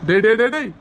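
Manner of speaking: loud, repeated taunting call; defiant challenge. Acted. Defiant answer to rival's threat.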